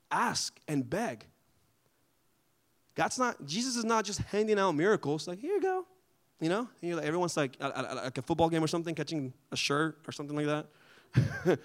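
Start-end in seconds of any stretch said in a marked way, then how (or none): 5.4-5.9 casual handout. Retold or imitated. Jesus casually handing out miracles.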